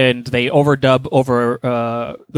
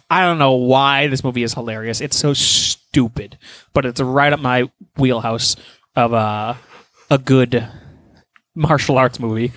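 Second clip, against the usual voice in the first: usual speaking voice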